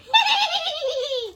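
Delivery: high squeaky villain cackle; rat's high evil cackle; evil squeaky laugh. Acted. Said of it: "evil, squeaky laugh".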